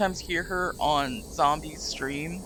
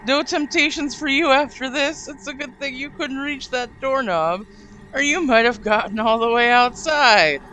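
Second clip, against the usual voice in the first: vengeful cat; 5 semitones higher, 5 dB louder than usual. Acted. Cat's voice vowing revenge.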